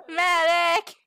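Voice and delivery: high, loud medic call; man down shout. Pretend. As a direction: yell medic like on a battlefield. Calling out for a medic.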